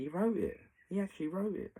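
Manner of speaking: retelling her outburst, nearly shouting; emphatic insistence. Retold or imitated. Friend insisting cop wrote statement.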